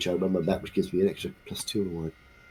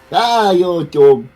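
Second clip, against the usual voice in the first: loud, higher mock insult easing off; shouted abuse; 4 semitones higher, 12 dB louder, slower than usual. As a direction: shout a silly insult, then tail off. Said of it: "you shout abuse and go".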